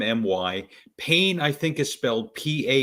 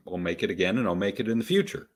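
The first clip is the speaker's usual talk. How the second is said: normal talking voice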